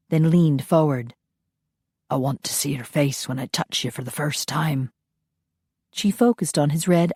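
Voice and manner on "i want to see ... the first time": soft, low Highland brogue; low Scottish murmur. Acted. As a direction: murmur it close, like a Scottish lover. Ian speaks low, intimately.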